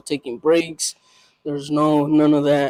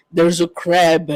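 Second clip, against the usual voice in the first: usual speaking voice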